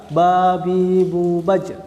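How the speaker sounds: "ba, bi, bu, baj" chanted as a drill, the final j stopped hard and held; jim drill with held sound. Shown as a demonstration. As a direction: clip each syllable, then lock the closing "baj" shut. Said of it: "dibaca super suara tertahan".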